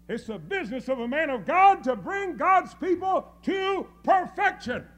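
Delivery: loud, high, hammering pulpit delivery; full-force preaching. Real, not acted. Preacher persuading men to hear the truth, full-force preaching. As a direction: drive each phrase home to the congregation.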